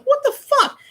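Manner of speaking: high incredulous squeak; mock disbelief. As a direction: squeal it in shock. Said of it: "I'm like".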